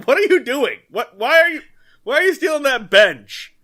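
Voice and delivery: high, loud incredulous questioning; mock bewildered shout. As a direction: call after him in baffled protest. Crowd calling after bench thief.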